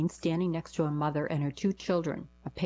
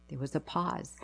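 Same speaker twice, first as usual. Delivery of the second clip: usual speaking voice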